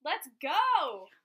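loud hype shout. Real, not acted. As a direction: pump up the listeners.

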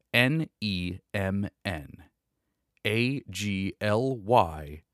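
letters read slowly, one by one; slow spelled out. Real, not acted. Reading out a code slowly.

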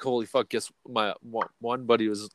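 ordinary talk

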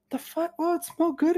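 high-pitched defensive squeak; high squeaky retort. Retold or imitated. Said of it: "I was like".

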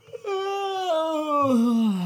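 long high-pitched yawn; drawn-out tired yawn. Real, not acted. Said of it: "That's why I'm yawning".

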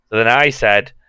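usual speaking voice

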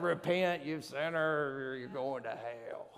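slow, deep, menacing doom voice; low ominous condemning drawl; hellfire preacher. Retold or imitated. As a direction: growl it like a fire-and-brimstone preacher. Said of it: "It's not a bad word like".

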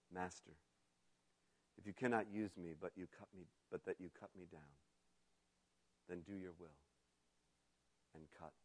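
hushed, yielding surrender; near whisper. A narrator's reading. Bamboo gives in to his master, hushed. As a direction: barely breathe it out. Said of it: "and there came a whisper".

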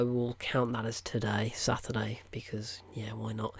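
sneaky whispered aside; conspiratorial little whisper. Real, not acted. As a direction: drop to a whisper, half to yourself. Aside whispered about counting the film.

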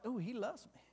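hushed, wincing acknowledgement; acted pained realization. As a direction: say it small, as if just chastened. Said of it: "when you feel that".

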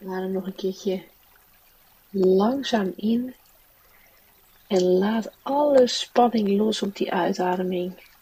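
ordinary delivery

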